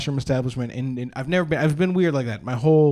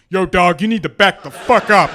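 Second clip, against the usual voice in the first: loud, raised put-on tough voice; intimidating tough guy; 4 semitones higher, 6 dB louder than usual. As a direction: scare him off with a big street-tough warning.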